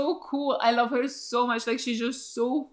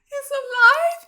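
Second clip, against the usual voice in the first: much higher, louder stunned question; 13 semitones higher, 6 dB louder than usual. Real, not acted. To the screen, stunned that Spike lives. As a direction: ask it in total disbelief.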